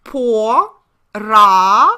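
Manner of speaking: "Po" then "ra" said as separate syllables with a pause. Shown as a demonstration. Teacher deciphering pravda piece by piece for the class. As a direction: break the word "Po, ra" into its parts. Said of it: "let me decipher it for you".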